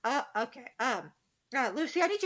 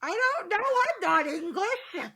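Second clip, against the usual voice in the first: laughing; 7 semitones higher, 5 dB louder, slower than usual. Real, not acted. Laughing reply about heat.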